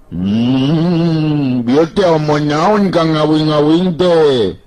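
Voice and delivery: put-on high, slow, drawn-out voice of a tired young fellow; Banteng Bulung voice. Banteng Bulung grumbles to Rawing about hunger, high and drawn-out. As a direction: stretch the words out high and slow, complaining. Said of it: "Si Banteng Bulung ceplak".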